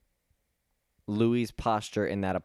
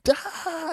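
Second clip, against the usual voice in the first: very high "ah!"; imitated high squeal on spotting someone; mock excited cry, pitched way up; delighted cry of recognition. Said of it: "he turns and goes".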